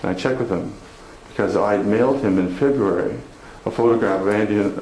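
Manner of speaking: ordinary talk, nothing marked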